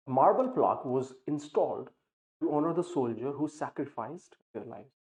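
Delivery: very slow, deliberate reading of an example sentence. Acted. Teacher reads the example sentence to students, slow dictation pace. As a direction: read it out word by word for learners.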